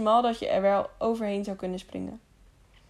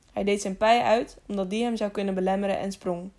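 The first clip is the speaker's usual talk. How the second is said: ordinary delivery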